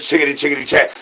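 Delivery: normal talking voice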